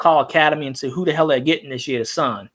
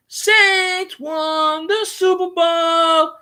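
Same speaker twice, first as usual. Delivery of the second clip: gloating fan; 11 semitones higher, much slower than usual. Pretend. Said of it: "Gonna be like".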